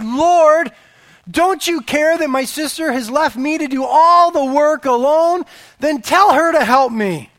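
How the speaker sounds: loud, high, exasperated complaint; Martha's complaint. Acted. Busy hostess complaining to Jesus, loud and high. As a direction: plead like a frazzled host left alone with the work.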